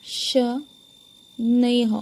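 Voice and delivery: शनैः drawn out very slowly, ending on a clear breathy h; teacher's slow model of शनैः with a clear final h; very slow visarga. Shown as a demonstration. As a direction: stretch शनैः right out and land on a clear h. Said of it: "वहाँ पे उच्चारण क्या होगा? ह।".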